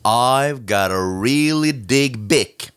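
slow, deliberate English sticker reading; measured, drawn-out reading. Acted. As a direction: spell out each word for the wordplay. Reads sticker text aloud.

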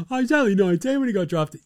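high, fast mock excuse; mocking imitation. Host mimics people excusing the player's age, high mocking. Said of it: "how's the fucking people go".

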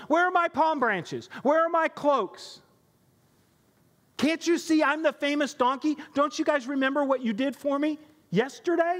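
an indignant donkey wailing at the crowd; frustrated donkey. Acted. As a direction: plead like a forgotten celebrity donkey. Frustrated ignored donkey complaining.

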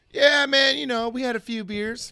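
put-on high, loud drunk buddy voice; drunk friend. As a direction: play the cheerful drunk friend.

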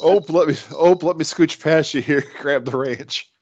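put-on loud, cheery Midwestern Iowa accent; hammed-up Iowan scooching past. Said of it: "ope's a big Iowa thing".

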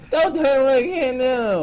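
high, loud defiant Locke line. Retold or imitated. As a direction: belt it out like Locke. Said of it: "When Locke first says it".

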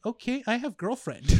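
mock Russian accent. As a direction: parrot the Russian accent back. Mimics her accented broken English back.

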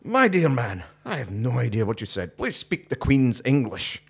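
mock-proper English gentleman's accent; best English accent. Englishman answering a Highlander he cannot understand, English accent. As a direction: put on a haughty Queen's English accent. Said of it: "My best English accent".

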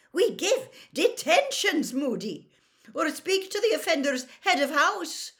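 high, loud, scolding lecture; scandalised McGonagall. A narrator's reading. McGonagall corrects Moody on punishments, scandalised. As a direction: lay down the rules in exasperation.

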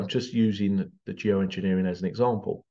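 ordinary delivery

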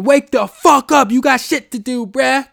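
high, loud screaming wake-up call; mock phone alarm scream. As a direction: yell it like an alarm blaring. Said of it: "screaming at me going".

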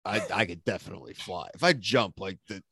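softly talking himself into it; low, hushed pretend pep talk to yourself; mock self-persuasion. Man convincing himself his powers will kick in, hushed mock musing. Acting out himself before the jump.

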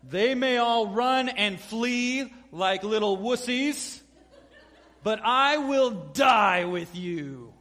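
loud, slow, booming vow; hammed-up booming fisherman pledge. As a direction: boom like a burly fisherman. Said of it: "in a booming fisherman voice".

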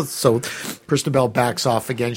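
ordinary talk

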